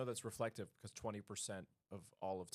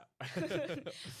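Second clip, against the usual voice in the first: laughing; 9 semitones higher, 5 dB louder than usual. Real, not acted. Laughing fit.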